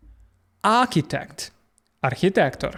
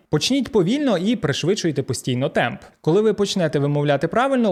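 normal talking voice

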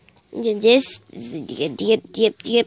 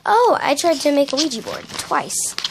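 ordinary delivery

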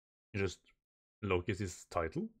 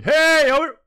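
much higher, loud shouted call; Luffy shouting; 15 dB louder than usual. Acted. Luffy calling loudly to the giants. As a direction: yell it across to the giants. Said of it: "Luffy going".

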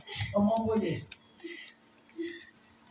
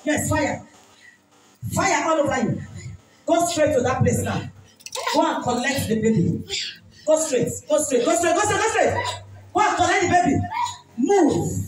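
loud, higher commanding deliverance shouts; preaching at full force; 8 semitones higher, 4 dB louder than usual. Real, not acted. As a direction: drive the spirit out with shouted commands.

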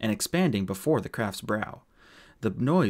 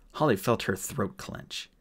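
ordinary delivery, nothing marked

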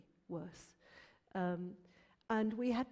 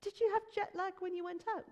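much higher voiced question; inquiring mum; 11 semitones higher, 4 dB louder than usual. Mum asking son about jet lag, incredulous. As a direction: pitch it up high, probing in mild disbelief.

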